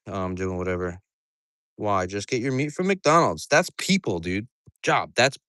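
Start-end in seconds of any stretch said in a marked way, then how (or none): none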